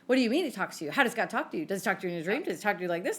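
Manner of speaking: mock interrogation, starting loud and easing off; imitated grilling. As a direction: act out someone pressing hard for answers. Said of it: "just grilling me".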